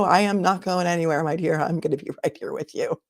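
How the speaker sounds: usual speaking voice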